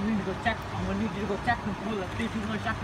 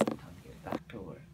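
much lower, much softer aside; cold low voice; 14 semitones lower, 14 dB quieter than usual. Acted. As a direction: say it flat and cold. Quiet after shooting.

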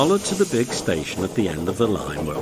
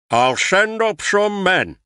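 Fat Controller; 11 semitones higher than usual. A narrator's reading.

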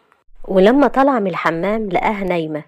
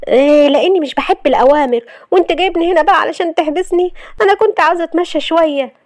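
high, louder sassy wife answering back; defiant young wife; 10 semitones higher, 3 dB louder than usual. Acted. Wife defying her husband's orders, high and sassy. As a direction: toss it back at him, defiant.

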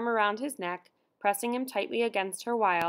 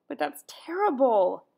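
higher, louder, slow anguished wail; 5 semitones higher, 5 dB louder than usual. A narrator's reading. Mother hears of the woman paraded through town, wailing. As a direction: let the horror pour out in a drawn-out cry. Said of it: "she wailed".

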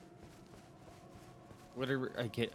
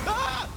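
startled scream; 10 dB louder than usual. Real, not acted. Startled by enemy.

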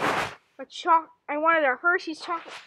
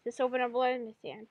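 usual speaking voice

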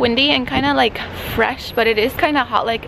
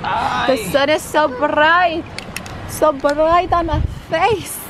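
slow, drawn-out whine about the sun; slow dragged-out moan; slow complaint; slower than usual. Real, not acted. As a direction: stretch every word, squinting.

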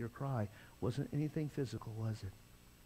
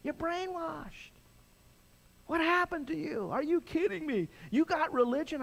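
a touch of high mocking disbelief; mocking friends; 12 semitones higher, 3 dB louder than usual. Friends scoffing at a new believer, mild mock disbelief. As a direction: toss it off like scoffing buddies. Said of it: "all my friends came and said".

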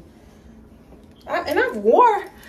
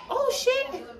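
excited exclamation; 12 semitones higher than usual. Real, not acted. Excited reaction to wig.

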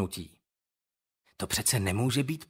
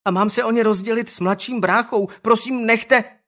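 put-on young boy begging, higher and louder; higher pleading voice; 9 semitones higher, 7 dB louder than usual. Younger boy begs bullies to stop, higher pleading. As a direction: plead desperately. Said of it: "vyšší hlas".

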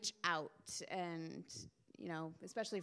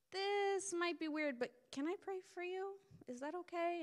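timid ask; 10 semitones higher than usual. Retold or imitated. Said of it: "And I was like".

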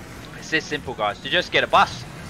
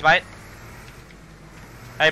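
ordinary delivery

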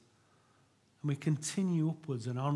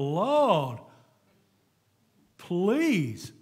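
loud, drawn-out mock plea; hammed-up desperate prayer, swelling high and loud; pleading cry; 4 semitones higher, 8 dB louder than usual. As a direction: cry out to heaven, stretching each word. Acts out desperate prayer for help.

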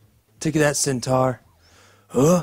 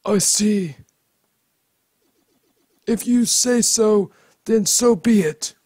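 higher character voice; 5 semitones higher, slower than usual. Acted. Voicing another character.

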